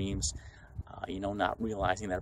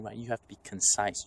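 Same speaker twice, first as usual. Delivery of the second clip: ordinary talk, nothing marked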